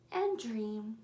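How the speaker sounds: ordinary delivery, nothing marked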